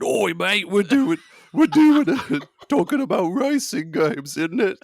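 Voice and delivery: boisterous mock-British lad; British accent. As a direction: put on a broad British accent, breaking into laughter. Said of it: "I'm just gonna go British".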